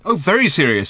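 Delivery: loud, high sarcastic echo; mocking shout. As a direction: throw his words back at him mockingly. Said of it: "he shouted".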